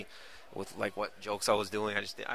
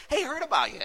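much higher, louder breezy greeting; schmoozing agent; 6 dB louder than usual. Retold or imitated. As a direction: play a slick Hollywood agent. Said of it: "coming down going".

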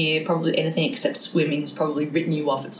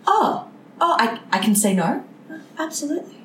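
surprised relief; 8 semitones higher than usual. Retold or imitated. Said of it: "Yeah, like".

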